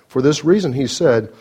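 ordinary talk, nothing marked

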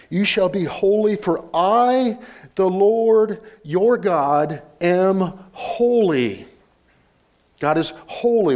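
slow, high, drawn-out proclamation; emphatic preaching. Acted. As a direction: declare it word by word to a congregation.

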